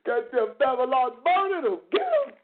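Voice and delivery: high-pitched zealot calling down judgment; mock hellfire preacher. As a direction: preach damnation in a hammed-up rising cry. Mock fire-and-brimstone preacher.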